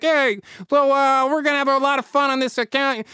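high-pitched, jovial mock director; Kim Jong-il. Dictator acts like a film director welcoming her, comic voice. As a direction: play a chummy director on set. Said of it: "he's the director".

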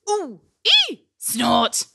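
high little yelps, then a snort; pained ooh, ee, snort. A narrator's reading. Narrator sounds out Rudolph's tumble on the roof, comic pain. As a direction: squeak out comic pain noises. Followed by an ouch.

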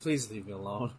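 put-on low, soft little old-mother plea; mock elderly mother. Elderly mother at her son's wrestling match, hushed mock plea. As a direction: play a meek parent wanting to be left be.

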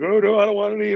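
high, scared little backing-down voice; mock timid opponent. Retelling opponents backing away, in a high mock timid voice. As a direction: play the rattled opponent who wants no part of it. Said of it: "they're like".

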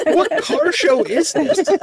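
baffled viewer asking the show; incredulous. Retold or imitated. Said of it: "And you're like".

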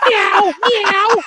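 high playful meowing; cat meow. Pretend.

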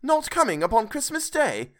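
crestfallen Bob. A narrator's reading. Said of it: "sudden declension in his high spirits".

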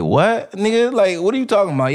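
high-pitched, baffled comeback. Retold or imitated. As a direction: react as if the slang makes no sense. Retold baffled reply to odd slang.

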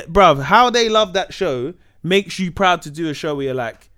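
ordinary delivery

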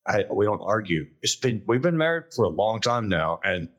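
usual speaking voice

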